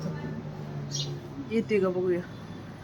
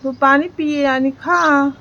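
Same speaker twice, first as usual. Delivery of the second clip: slow coaxing; 4 dB louder, much slower than usual. Acted. Coaxing the sparrow to calm.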